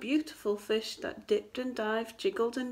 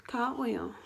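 ordinary delivery, nothing marked

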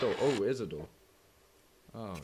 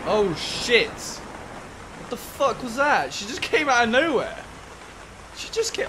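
loud, high startled outburst; shocked yelling after a scare; 9 semitones higher, 8 dB louder, slower than usual. Real, not acted. Player yelling at a sudden figure, startled.

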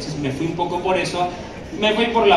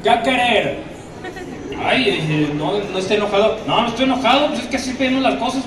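brisk blunt northern Mexican waiter, higher; 3 semitones higher than usual. Shown as a demonstration. Norteño waiter taking an order, in a blunt northern Mexican accent. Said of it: "es golpeado".

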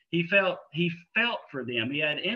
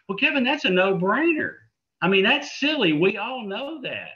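scoffing listener; 6 semitones higher, 6 dB louder than usual. Retold or imitated. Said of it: "some of you are thinking".

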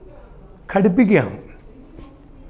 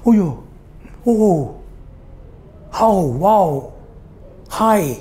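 demonstrated exclamations, spaced apart; mock little cries of surprise; sudden-feeling interjections; slower than usual. Shown as a demonstration. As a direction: act out each interjection lightly, one by one. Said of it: "ഒരു humming സൗണ്ടാണ്".

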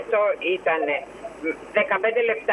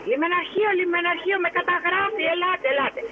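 imitating him yelling for the port police, pitched higher; mock yell, voice jumping higher, as if calling for help; retold shouting; 10 semitones higher than usual. Ship officer calling the port police, imitated mock shouting pitched higher. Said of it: "Και αρχίζει και φωνάζει".

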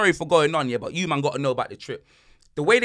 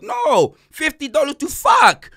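emphatic correction; 11 semitones higher, 7 dB louder, slower than usual. Retold or imitated. Quoted man corrects his misunderstanding.

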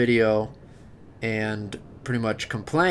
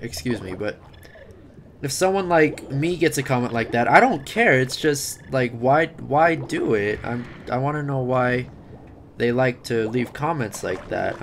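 ordinary delivery

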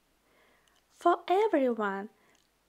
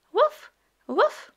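high, loud puppy woof; puppy bark; 9 semitones higher, 6 dB louder than usual. Acted. Voicing the puppy Biscuit barking.

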